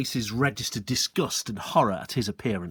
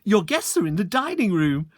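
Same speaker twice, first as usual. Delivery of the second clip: higher bright mum; mother's voice; 8 semitones higher, 4 dB louder than usual. Acted. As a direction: sing it out cheerfully to her son. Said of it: "she said to him".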